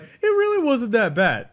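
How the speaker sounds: high, loud mock defence of the show; mock defender. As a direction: squeak it out like a naive fan. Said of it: "was just like".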